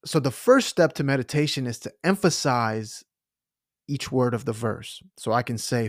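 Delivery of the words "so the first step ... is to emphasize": ordinary talk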